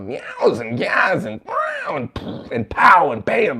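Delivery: high, punchy comic-book noises; exaggerated onomatopoeia; imitated sound effects. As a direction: act out each sound effect as it lands. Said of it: "a lot of onomatopoeia".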